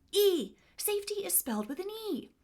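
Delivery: shouted, high and loud; wife yells a correction to the husband; yelling across house. Retold or imitated. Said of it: "At the top of my lungs, I yell out".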